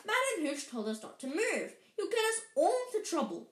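urgent scolding that climbs high and speeds up; shouted warning. A narrator's reading. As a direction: plead with a classmate to stop. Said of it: "shouted Hermione Granger".

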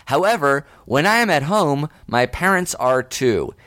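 performed letter read-aloud. Acted. Reads listener's letter aloud.

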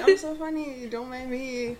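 high, loud sarcastic brag; mock self-praise. Teasing herself in a retold chat, sarcastic. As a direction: tease yourself playfully.

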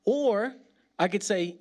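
ordinary talk, nothing marked

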